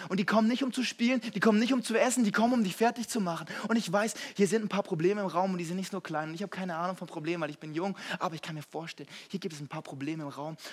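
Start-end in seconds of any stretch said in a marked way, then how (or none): 0.1-6.3 rising, loud sermon delivery; fiery preacher hammering a point; preaching at full force. Acted. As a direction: preach it, pushing each line harder. Preaching builds to a climax.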